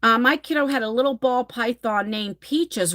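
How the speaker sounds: ordinary talk, nothing marked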